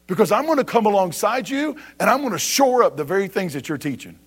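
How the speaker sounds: ordinary talk, nothing marked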